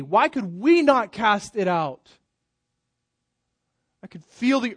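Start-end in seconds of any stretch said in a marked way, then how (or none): 0.0-2.1 slow, "we" pressed hard; stress on we. Shown as a demonstration. Preacher stressing the disciples' own failure. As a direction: linger and lean on "we". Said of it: "emphatic emphasis placed upon the "we".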